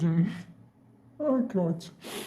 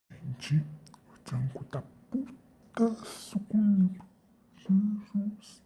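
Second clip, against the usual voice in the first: whispered aside to the viewers; conspiratorial whisper; 2 semitones higher, much slower than usual. Real, not acted. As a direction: whisper it secretly so the angry partner can't hear. Whispering to viewers behind partner's back.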